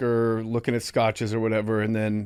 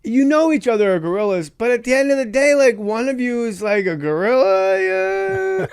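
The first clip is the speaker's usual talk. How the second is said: batshit crazy auteur; 10 semitones higher, 5 dB louder than usual. Retold or imitated. Director explaining gorillas to actors, batshit crazy auteur. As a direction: explain nonsense with earnest, high-pitched zeal. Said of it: "looking like a batshit crazy auteur".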